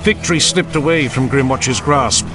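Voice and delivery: plain reading voice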